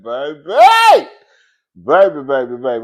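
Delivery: put-on shouting baby at the friend; loud exclaim. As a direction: yell it at her in disbelief. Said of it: "I said".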